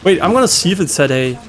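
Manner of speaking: ordinary talk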